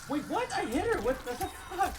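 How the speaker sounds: loud, high yell of disbelief; real outraged outburst, pitch shooting up; incredulous protest. Real, not acted. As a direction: protest shrilly that the game cheated you. Disputes a missed hit in game.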